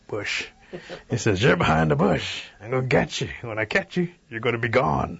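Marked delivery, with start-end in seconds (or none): 1.4-5.2 a touch of menace, voice lifted; threatening bear. A narrator's reading. Bear hears giggling behind the bush, mild angry threat.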